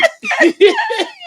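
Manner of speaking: burst of high laughter. Real, not acted. Laughing at sibling imitation.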